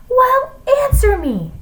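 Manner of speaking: very high, loud demand. A narrator's reading. Teacher losing patience with a mute pupil, demanding. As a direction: demand it in shrill exasperation. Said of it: "she demanded".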